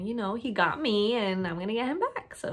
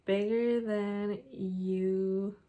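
dictating while typing; much slower than usual. Real, not acted. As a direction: stretch the words as you type. Typing the next line aloud.

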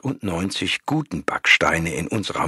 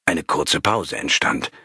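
usual speaking voice

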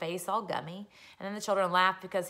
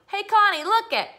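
put-on high, loud boyish holler; excited yell; 11 semitones higher, 9 dB louder than usual. Boy on the banister yells at Connie, excited showing off. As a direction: call out the stunt proudly. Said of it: "yelled at Connie".

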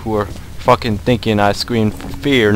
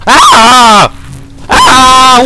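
repeated shrill screams, much higher and much louder; panicked screams; 14 semitones higher, 17 dB louder than usual. Real, not acted. Gamer attacked by a monster, screaming in panic. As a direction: yelp in terror as it lunges.

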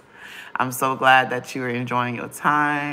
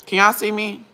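impatient; 7 semitones higher, 6 dB louder than usual. Real, not acted. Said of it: "I'm getting irritated".

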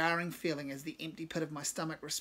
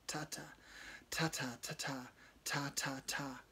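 whispered rhythm; 2 semitones lower, 8 dB quieter, slower than usual. Acted. Amber repeats rhythm quietly.

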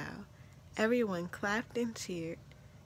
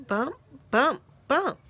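loud, higher-pitched bumps; sudden emphatic bumps, raised in pitch and volume; loud thumping; 5 semitones higher, 9 dB louder than usual. A narrator's reading. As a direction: punch out each bump like a startling knock. Louder knocks startle the conductor.

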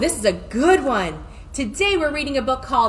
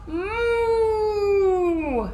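long, high mooing; cow moo; 7 semitones higher than usual. A narrator's reading. Imitates cow walking away.

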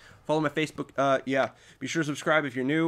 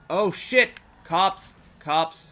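louder, startled call-out; real panic at the cops; alarmed shout; 3 semitones higher, 6 dB louder, much slower than usual. Real, not acted. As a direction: shout a warning. Cops appear in game.

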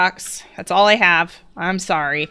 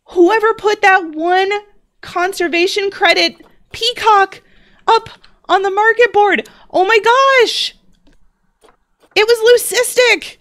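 much higher and louder, bursting with joy; thrilled and gushing; 10 semitones higher, 3 dB louder than usual. Real, not acted. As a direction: gush over a rare find, fully delighted. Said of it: "way more excited than I need to be".

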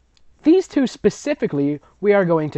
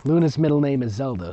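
ordinary delivery

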